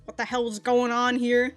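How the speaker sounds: ordinary delivery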